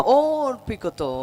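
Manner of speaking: loud, slow, drawn-out scripture call; proclaimed verse. Real, not acted. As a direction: raise the pitch and stretch each word out. Echoes the verse for congregation.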